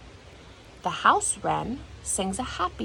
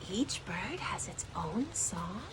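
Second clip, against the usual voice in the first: whisper; 9 dB quieter than usual. Acted. Whispered aside.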